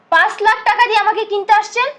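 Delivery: loud, high indignant question; outraged challenge. Acted. Woman to the man buying her, outraged challenge.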